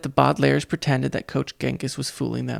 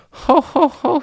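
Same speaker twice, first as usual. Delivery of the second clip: loud falsetto fake laugh; high booming "ho ho ho"; 13 semitones higher, 6 dB louder, slower than usual. A narrator's reading. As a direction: laugh it off loudly. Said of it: "he said".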